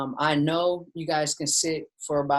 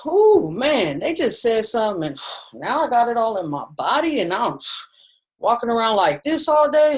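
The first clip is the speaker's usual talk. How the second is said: higher, louder, exasperated sighing rant; upset inner voice; 6 semitones higher, 5 dB louder than usual. Retold or imitated. A stressed person venting to themselves, higher and louder with sighs. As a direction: vent like the body is full of stress. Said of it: "I notice".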